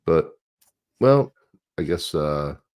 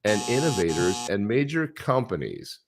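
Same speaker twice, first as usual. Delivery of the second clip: higher, measured read-aloud of a political statement; mock statement reading; 4 semitones higher than usual. Host reading the candidate's crypto comments, mock-formal read-aloud. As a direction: read it out deliberately, pitch lifted.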